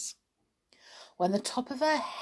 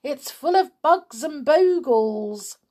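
higher-pitched warning; mildly raised cautioning voice; calling out; 6 semitones higher, 4 dB louder than usual. A narrator's reading. George warns Grandma of attic bugs, cautioning call. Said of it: "George called out".

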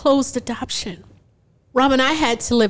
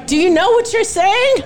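incredulous; 10 semitones higher, 4 dB louder than usual. Retold or imitated. Incredulous at husband refusing delivery room.

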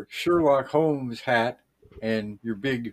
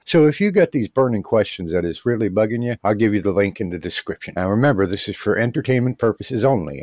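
loud, hammed-up ad pitch; podcast host read; 7 dB louder than usual. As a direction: sell it like a cheesy radio host.

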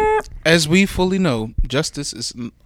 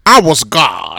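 lightly voiced godlike movie narrator; a low, grand proclamation; Morgan Freeman impression; 4 semitones lower, 3 dB louder, much slower than usual. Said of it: "Morgan Freeman played God".

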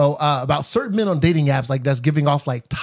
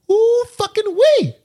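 high, loud ooh; host reacting to the big topics; excited ooh; 6 dB louder than usual. Real, not acted. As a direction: exclaim up high. Exclaims over topic list.

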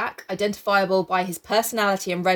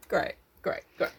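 whispered sarcasm; 6 dB quieter than usual. Real, not acted. Sarcastic reply.

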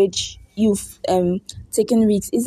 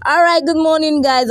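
usual speaking voice

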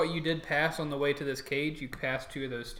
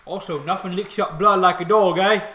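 loud, higher orc voice; joking orc; 6 semitones higher, 14 dB louder than usual. Acted. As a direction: crack a joke as an orc guard. Orc guard jokes while petting dog.

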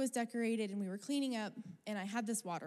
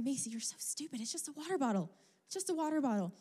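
self-scolding; 5 semitones higher than usual. Retold or imitated. Searching the dark park, scolding herself, rising exasperation. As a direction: scold yourself for fretting over a bottle.

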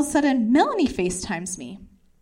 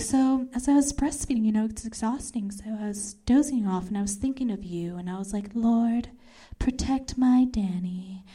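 soft, subdued storytelling; hushed retelling; 6 dB quieter than usual. Retold or imitated. Breastfeeding friend recalls praying for her, softly. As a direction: murmur the account gently. Friend's drowsy bedtime prayer retold.

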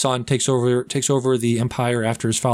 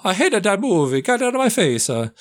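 much higher, louder hammed-up tantrum; over-the-top character rant, Italian accent; shrill mock rant; 10 semitones higher, 4 dB louder than usual. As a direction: screech it like a spoiled brat. Mocking Jared Leto's character.

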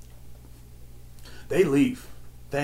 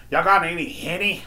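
demanding customer; 5 semitones higher than usual. Retold or imitated. Customer asks the clerk for Henny, demanding. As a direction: imitate a demanding store customer.

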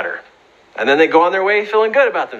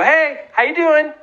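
friendly greeting; 11 semitones higher than usual. Retold or imitated. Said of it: "he'd wave to him and go".

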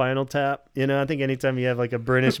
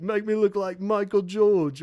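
mock Simon; 8 semitones higher than usual. Retelling Simon goofing on [name].

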